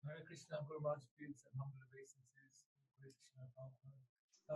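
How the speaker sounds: very faint voice, far from the mic; speaking too softly to be heard; barely audible. Real, not acted. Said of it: "Your voice is very low".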